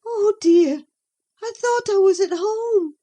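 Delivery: high, loud, with a sigh of relief and shame. A narrator's reading. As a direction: let the dread drain into a sigh. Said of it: "she sighed".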